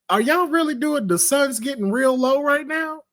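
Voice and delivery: high, incredulous mock voice; mocking disbelief. Scoffing at the movie's cheesy sunset ending, mocking high voice. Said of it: "I was like".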